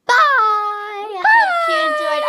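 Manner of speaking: high, loud, squealing goodbye; excited goodbye. Real, not acted. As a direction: squeal it to the viewers. Excited sign-off to viewers.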